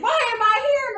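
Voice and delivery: high, loud mock wail; exaggerated plaintive question, imitating a tearful moment; retold crying. Retelling crying after unloading, in a high mock wail. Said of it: "and cry. And I'd be like".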